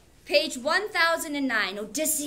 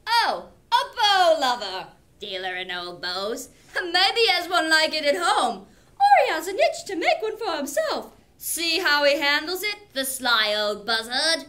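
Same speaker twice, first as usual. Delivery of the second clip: higher, louder jeering voice; mocking suitors; 3 semitones higher, 6 dB louder than usual. Teacher voices the suitors mocking the old beggar. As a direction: sneer at the beggar like a smug suitor. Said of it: "some jested among themselves".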